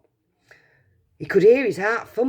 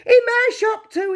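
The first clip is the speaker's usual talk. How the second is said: much higher, louder yell; 7 dB louder than usual. Acted. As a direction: yell it out, overjoyed. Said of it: "he yelled".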